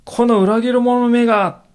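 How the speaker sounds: slow, loud, high furious cry of betrayal; angry shout. Shown as a demonstration. Preacher shouting at a traitor in fury. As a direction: hurl the accusation at the traitor. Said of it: "とこう叫んだらね".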